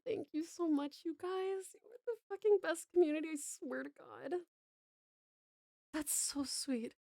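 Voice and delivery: usual speaking voice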